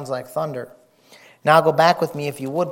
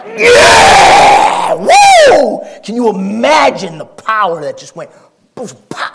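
excited shout; 8 semitones higher, 14 dB louder, slower than usual. Real, not acted. Preacher erupting in excitement.

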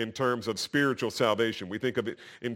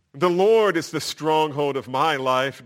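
slow, louder scripture proclamation; declaring; 4 semitones higher, 9 dB louder than usual. Retold or imitated. Preacher voices David's confidence in God, declaring. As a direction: proclaim it with conviction. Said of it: "David says".

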